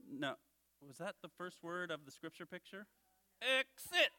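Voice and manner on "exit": Bones. Pretend. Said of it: "Well, Bones".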